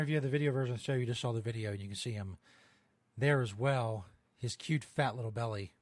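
normal talking voice

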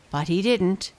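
ordinary delivery, nothing marked

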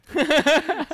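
sudden high-pitched laugh; high burst of laughter. Real, not acted. Laughing at awkward plug.

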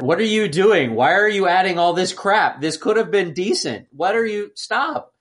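normal talking voice

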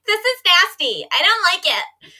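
high, loud squeamish whine; disgusted. Retold or imitated. To the movie's gross scene, a loud disgusted whine. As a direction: act out a grossed-out kid. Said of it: "I was like".

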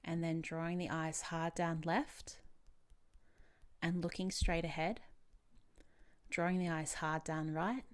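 calm, unhurried instructions with long pauses; guided meditation. Real, not acted. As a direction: lead a relaxation exercise gently.

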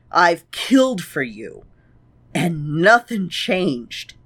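ordinary talk, nothing marked

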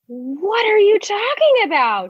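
high, incredulous retort; mock exasperation. Woman confronting her boyfriend, mock exasperation. Retold quarrel, baffled at his claim.

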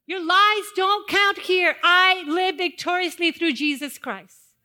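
high, loud shout; bold rebuke. Shown as a demonstration. Pastor leading the church to rebuke, shouting. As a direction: shout it at the enemy. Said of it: "You wanna say it right now?".

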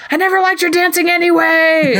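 high-pitched mock yell; mock outburst. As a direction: blurt it high, like a hammed-up dance partner. Acted dance-partner outburst before a slam.

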